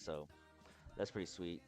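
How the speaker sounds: usual speaking voice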